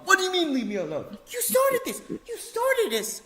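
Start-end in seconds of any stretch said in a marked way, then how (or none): none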